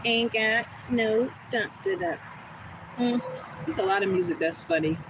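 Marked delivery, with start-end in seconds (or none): none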